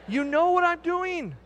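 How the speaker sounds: high, drawn-out mock plea; hammed-up, high-pitched pleading; mock exasperation. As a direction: stretch it out high in comic protest. Joking plea to wife, louder repeat.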